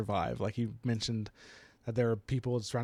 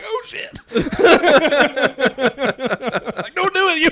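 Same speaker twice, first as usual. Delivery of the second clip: high, loud cry through laughter; helpless laughing yell; laughing mock alarm; 15 dB louder, much slower than usual. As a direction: shout a warning while cracking up. Mock alarm at character's choice, laughing.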